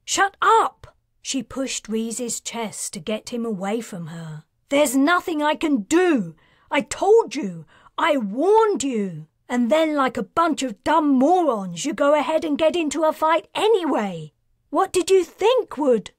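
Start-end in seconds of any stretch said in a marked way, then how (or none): 0.0-1.0 high, full-force shout. A narrator's reading. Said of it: "Karina shouted". 4.7-16.1 loud, high angry tirade; furious yelling. A narrator's reading. Karina furious at doomed mercs.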